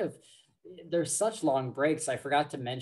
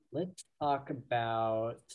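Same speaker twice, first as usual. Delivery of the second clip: ordinary talk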